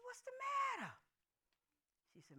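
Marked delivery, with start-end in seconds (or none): 0.0-0.9 high, soft anxious question; worried mother. Retold or imitated. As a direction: ask with pitched-up concern. Mother alarmed by daughter's news.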